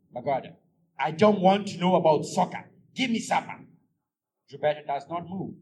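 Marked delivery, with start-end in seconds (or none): 1.0-3.6 angry demand. Acted. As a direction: cut her off, angry. Husband refuses, demands supper.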